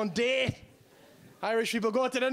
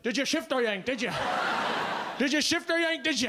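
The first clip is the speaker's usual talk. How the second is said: Wexford lads; 3 semitones higher than usual. Retold or imitated. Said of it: "the boys were like".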